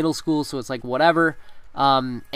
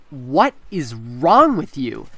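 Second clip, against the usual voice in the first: stern teacher; 2 semitones lower, 4 dB louder, much slower than usual. Retold or imitated. Angry teacher confronting him.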